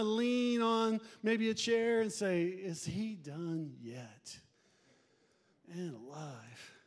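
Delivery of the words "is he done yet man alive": slow, low, sinking complaint; mock bored grumble. Back-row member waiting for sermon to end, wearily impatient. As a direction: drag it out softly, fed up.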